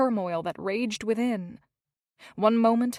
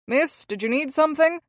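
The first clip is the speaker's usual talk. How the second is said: a stern man's call, raised and higher; man calling after her; 5 semitones higher, 5 dB louder than usual. A narrator's reading. Stranger in the hall calls after fleeing Hazel, raised voice. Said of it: "she heard a voice call to her back".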